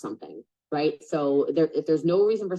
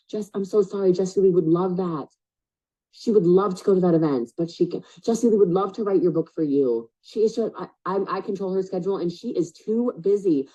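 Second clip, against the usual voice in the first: higher, louder, overly apologetic; fake assistant voice; 4 semitones higher, 4 dB louder than usual. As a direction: play a staff member turning people down. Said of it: "It's like".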